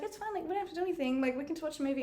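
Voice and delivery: soft, high coaxing voice; hushed, sweet coaxing; retold reassurance. Voicing girl reassuring her in story.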